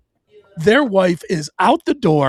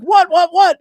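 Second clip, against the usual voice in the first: loud, high, rapid-fire whats; wife rushing in, calling loudly; alarmed what; 13 semitones higher, 7 dB louder than usual. Retold or imitated. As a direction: hurry up the stairs calling what. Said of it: "she's like".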